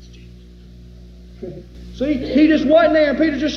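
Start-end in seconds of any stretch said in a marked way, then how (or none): 0.0-1.6 very soft, slow, breathless whisper; stunned whisper. Retold or imitated. Peter seeing Jesus suddenly, awed whisper. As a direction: barely breathe it out in awe. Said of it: "He said".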